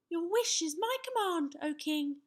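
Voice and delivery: put-on high-pitched boyish knight; little knight declaring. A narrator's reading. Young knight pledges himself to the king, declaring. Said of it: "Sir Scallywag declared".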